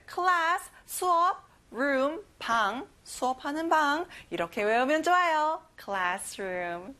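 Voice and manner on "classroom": slow, soft model of classroom; model word. Shown as a demonstration. Teacher models "classroom" softly, rising at the end. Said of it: "발음과 강세 연습해 볼게요".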